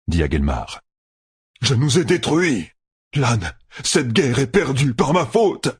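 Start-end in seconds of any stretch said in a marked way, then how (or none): 1.6-5.8 high-pitched despair, slowing down; anguished confession. A narrator's reading. General stopped from suicide blames himself, in despair.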